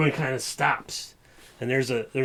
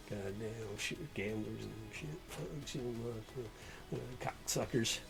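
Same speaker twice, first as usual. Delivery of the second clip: grumbling sheriff; 4 semitones lower, 18 dB quieter than usual. Acted.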